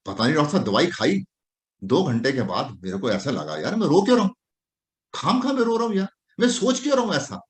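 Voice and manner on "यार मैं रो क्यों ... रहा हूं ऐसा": puzzled patient. Acted. Doctor voices the puzzled patient.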